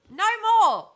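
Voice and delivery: high, loud final declaration; loud shout. Real, not acted. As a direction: shout it to end the matter. Loud declaration after rant.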